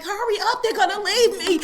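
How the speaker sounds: high, loud, laughing hurry-up; laughing mock urgency. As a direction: playfully nag the driver to speed up. Urging slow-driving husband, laughing.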